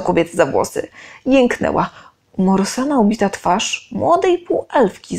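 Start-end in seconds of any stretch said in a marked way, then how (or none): none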